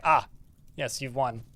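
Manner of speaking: soft, put-on voice conceding defeat; mock grand concession. Player mocking a Minotaur admitting defeat, soft hammed-up voice. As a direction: hand over the victory with mock solemnity. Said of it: "it's like".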